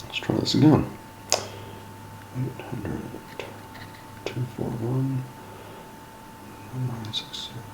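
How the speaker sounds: soft, under-the-breath reading of digits; muttering to self. Real, not acted. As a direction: mumble the number to yourself while dialing. Mutters the number while dialing.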